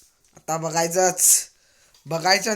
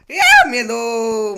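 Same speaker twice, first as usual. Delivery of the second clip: dragged-out cry; 6 semitones higher, 11 dB louder, much slower than usual. Real, not acted. Dying in game.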